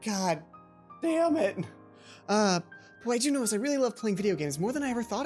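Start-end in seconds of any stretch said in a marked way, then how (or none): none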